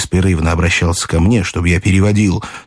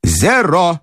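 high, ringing call without much volume; croupier's call; 9 semitones higher than usual. A narrator's reading. Croupier announcing the winning result, high call. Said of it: "крикнул крупёр".

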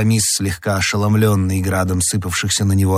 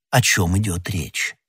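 plain reading voice

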